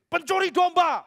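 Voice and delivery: hurler l'insulte, beaucoup plus aigu et fort; cri furieux. Retold or imitated. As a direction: accuser violemment. Orang berdiri membentak.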